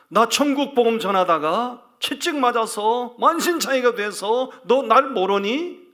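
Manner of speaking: the Lord rebuking the pastor, building from plain to a high loud cry; Jesus rebuking. Retold or imitated. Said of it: "주님이 책망을 하시대요".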